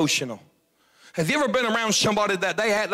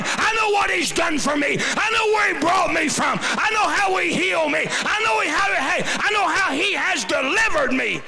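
full-force preaching; 13 semitones higher than usual. Real, not acted. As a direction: preach it hard, building the fire.

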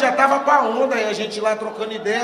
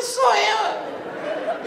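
laughing high reply; 9 semitones higher than usual. Retold or imitated.

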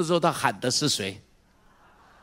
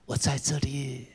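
soft slow whisper, much softer; father soothing a child in a whisper; God's tender whisper; 5 dB quieter, slower than usual. Retold or imitated. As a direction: hush it gently to a hurting child. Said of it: "上帝在那边笑笑说".

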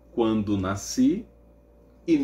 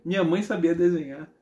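ordinary delivery

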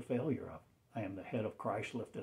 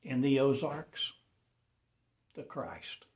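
proclaiming cry; 4 semitones higher, 6 dB louder than usual. Acted. Sudden outcry mid sermon.

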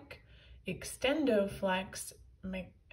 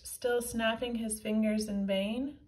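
usual speaking voice

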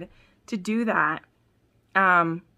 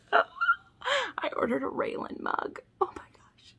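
breathless giddy whisper over a new mug; excited whisper; 5 dB quieter, slower than usual. Real, not acted. Fan whispering to her viewers about a new mug, excited whisper. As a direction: whisper it like a secret treasure.

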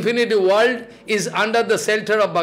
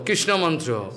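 ordinary delivery

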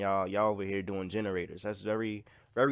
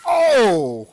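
high, very loud drawn-out "oh"; loud "oh" yell; 10 semitones higher, 17 dB louder than usual. Real, not acted. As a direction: yell out a long, falling cry. Attacks in the game.

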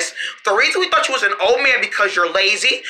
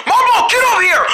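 calling out loud; 10 semitones higher, 4 dB louder than usual. Acted. Chasing Momo.

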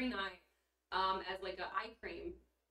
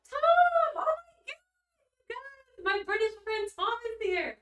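very high, loud squeal of delight; thrilled greeting to a friend who just showed up; excited squeal; 14 semitones higher, 10 dB louder than usual. Real, not acted. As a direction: shriek his name like a long-lost friend. Friend Tom arrives in chat.

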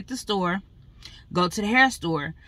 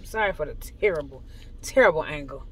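usual speaking voice

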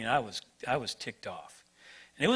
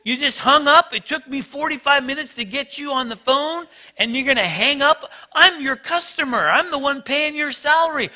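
much higher, louder incredulous rant; losing it; 8 semitones higher, 4 dB louder than usual. Retold or imitated. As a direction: rant at the clerk who hung up. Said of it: "I am losing it by now".

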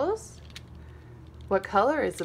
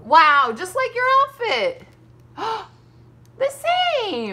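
excited; 11 semitones higher, 6 dB louder than usual. Real, not acted. Mom delighted by matching colors.

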